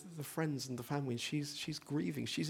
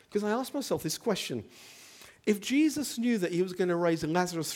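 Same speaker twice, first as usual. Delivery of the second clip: high, loud preaching at full force; impassioned preaching; 5 semitones higher, 7 dB louder than usual. Acted. Preacher pressing his flock with why Jesus wept, impassioned. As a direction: press the congregation with urgent questions.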